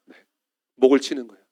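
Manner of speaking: ordinary delivery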